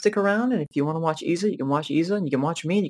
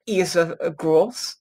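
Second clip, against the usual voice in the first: slow, mock German accent; 2 semitones higher, much slower than usual. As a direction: say the name slowly with a German accent. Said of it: "I was saying it in a German accent".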